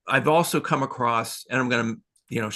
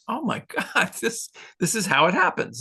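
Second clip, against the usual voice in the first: amazed realization; 8 semitones higher than usual. Retold or imitated. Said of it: "I'm like".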